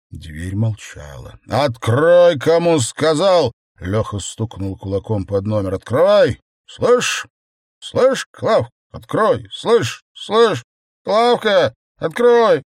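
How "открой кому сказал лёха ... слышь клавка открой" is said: put-on high, loud drunken yelling; echoing stairwell. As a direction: bellow her name into the stairwell. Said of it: "Его голос гулко разносился по подъезду". (гулкий пьяный крик в подъезде)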